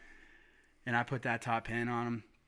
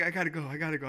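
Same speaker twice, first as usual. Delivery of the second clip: higher, hurried re-enacted excitement; retold urgency; 4 semitones higher, much faster than usual. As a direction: blurt it out, imitating your frantic self. Re-enacting frantic excited phone call.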